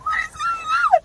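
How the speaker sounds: high shriek of amazement; shrieking disbelief. Real, not acted. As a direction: scream it out in disbelief. Stunned by the singing.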